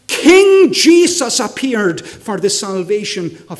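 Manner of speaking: loud, ringing sermon delivery; full-force preaching. Acted. Preacher asks the congregation why Jesus came, preaching at full force. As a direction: preach it out to the whole hall.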